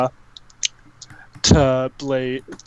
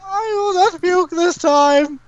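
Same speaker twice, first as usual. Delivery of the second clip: very high, slow wail; character voice; slower than usual. Acted. Player vowing in character not to puke, high strained wail. I got a voice.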